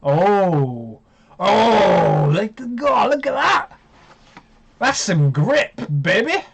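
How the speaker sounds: high excited put-on voice; hammed-up excitement, going high; funny voice. Real, not acted. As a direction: gush over the graphics in a silly voice. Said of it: "I don't know why I'm talking like that".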